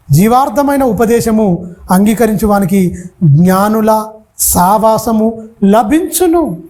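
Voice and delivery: every word drawn out slowly; slow, deliberate scripture reading; slow careful reading. Shown as a demonstration. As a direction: read it word by word so they can follow. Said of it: "ప్రతి మాట చాలా జాగ్రత్తగా మనం చదువుకుందాం".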